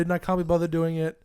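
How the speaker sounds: usual speaking voice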